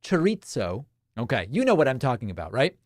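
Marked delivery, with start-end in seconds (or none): 0.0-0.8 chorizo in a British accent, with a ts sound; Briticized chorizo. Shown as a demonstration. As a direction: say chorizo the British way, cher-EET-so. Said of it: "or said in a British accent".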